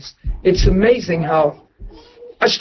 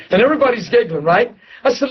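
ordinary talk, nothing marked

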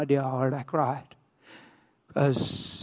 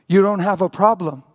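measured reply holding his ground; composed voice of God, only mildly raised; gentle, firm; 4 semitones higher, 5 dB louder than usual. Retold or imitated. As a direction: answer calmly, without screaming. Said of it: "God held his ground and whispered gently".

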